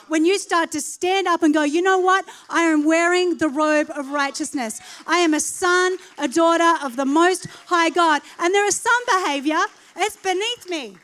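loud, ringing declaration of who you are; preacher declaring to the congregation, loud and sure; bold proclamation. Real, not acted. Preacher rallying his listeners, shouting the declaration.

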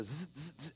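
imitated electric zaps of firing synapses; buzzing zaps. Shown as a demonstration. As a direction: buzz like sparks jumping.

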